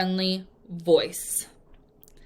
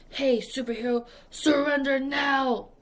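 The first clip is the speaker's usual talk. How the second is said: a hint of a movie villain growling; mock villain ordering surrender; gruff villain; 4 semitones higher than usual.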